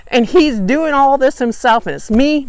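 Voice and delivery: usual speaking voice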